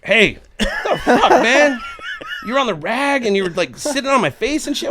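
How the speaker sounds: high, mock-outraged complaint; indignant confrontation. Man phoning the other girl, high-pitched retold indignation. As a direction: re-enact an incredulous telling-off. Second angry phone call retold.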